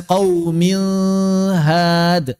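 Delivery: "qaumil had" with the n blurred into a held hum; nun disamarkan. Shown as a demonstration. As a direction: chant "qaumil had", masking the n before the h. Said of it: "Jangan disamarkan". ("qaumil had" dengan bunyi nun disamarkan)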